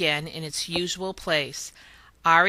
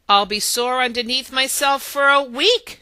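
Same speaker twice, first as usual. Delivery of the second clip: louder, higher grumble of a sore hen; grumbling; 5 semitones higher, 5 dB louder than usual. A narrator's reading. Hen grumbling to the leprechauns about the ball. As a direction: grouse about the sore seat ahead. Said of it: "she grumbled".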